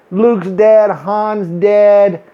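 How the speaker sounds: normal talking voice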